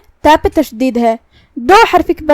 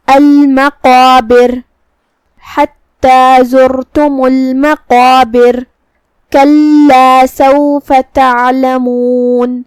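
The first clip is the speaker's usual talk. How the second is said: slow, drawn-out melodic tilawat; Quran recitation; 4 dB louder, much slower than usual. Shown as a demonstration. Teacher recites the verse for students, slow melodic chant. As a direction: chant each word long and measured like a qari.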